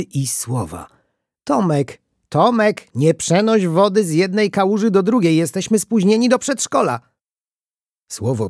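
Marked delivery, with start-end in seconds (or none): 1.4-7.0 raised, high, hurried scolding; urgent calling. Acted. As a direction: call out to a dawdling child. Hurried parent calls child to preschool.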